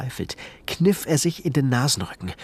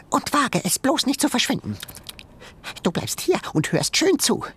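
high, bossy little animal voice; tiny creature voice; 14 semitones higher than usual. A narrator's reading. Shrew scolding Ben behind the glass, high creature voice. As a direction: scold like a pint-sized boss.

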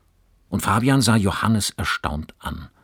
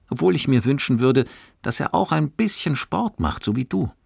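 mother's voice; 9 semitones higher than usual. Acted.

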